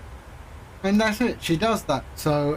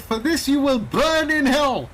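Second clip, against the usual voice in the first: higher, emphatic retold curse; mock damnation; 6 semitones higher than usual. Teller damning a scam caller in a retelling, mock-righteous. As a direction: pronounce doom on him, hammed-up.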